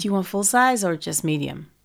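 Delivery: low, soft American server; attempted American accent. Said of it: "That was better".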